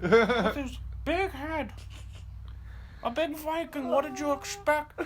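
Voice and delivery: high put-on old man voice; mock an old caller, pitched right up; complaining old man. Old customer complains about the Viking mover, high old man voice. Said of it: "the old guy was probably on the phone like".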